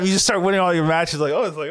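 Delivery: usual speaking voice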